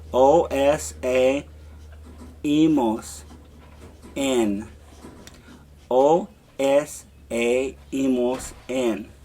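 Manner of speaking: endings spelled out slowly for repeating; slow chant for the class to repeat; slow drill. Shown as a demonstration. Teacher leading students in slow repetition. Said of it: "say it with me and repeat".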